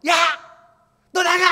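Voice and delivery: put-on sharp high shout at a child; parent yelling. Angry parent yelling at a child, shouted. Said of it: "이런 소리는 안 할 거 아니에요".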